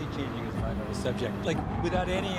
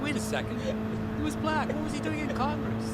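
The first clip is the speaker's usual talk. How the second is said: mock historian shock; 12 semitones higher than usual. Historians stunned reading old papers, high mock shock. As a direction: act like a stunned historian finding a secret. Historians imagined finding Malcolm's documents.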